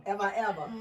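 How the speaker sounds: usual speaking voice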